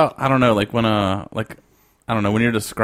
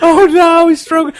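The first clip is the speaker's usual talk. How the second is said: high, loud mock alarm; mock panic; 6 dB louder than usual. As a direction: cry out in fake panic.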